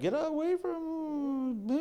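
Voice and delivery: high, slow squealed get away; hammed-up high-pitched refusal; mock high squeal. Retold refusal of hustlers.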